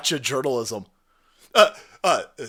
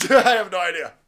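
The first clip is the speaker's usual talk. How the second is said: laughing; 7 dB louder than usual. Real, not acted. Repeated with a laugh.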